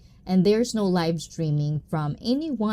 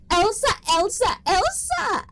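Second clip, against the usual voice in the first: high-pitched calling out; 13 semitones higher than usual. Real, not acted. Host calling out to a viewer's wife, high-pitched call. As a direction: sing out the name, climbing higher each time.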